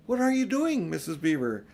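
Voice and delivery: high, loud startled cry; exclaimed. A narrator's reading. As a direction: burst out in alarm at the packing. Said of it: "exclaimed Susan".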